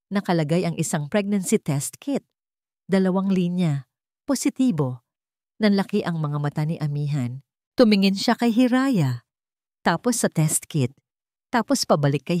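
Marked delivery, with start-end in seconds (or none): none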